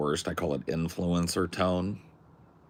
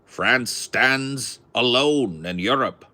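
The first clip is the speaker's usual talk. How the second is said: loud, slow transatlantic newsreel announcer, pitched up; old radio announcer; 7 semitones higher, 8 dB louder, slower than usual. Retold or imitated. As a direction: boom it out like a 1940s radio bulletin. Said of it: "old forties or thirties radio announcer".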